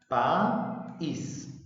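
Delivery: "País" slowly split as pa-ís; "País" with a clear gap between pa and ís; broken into syllables. Shown as a demonstration. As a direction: say "País" in two separate, drawn-out syllables. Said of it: "En sílabas".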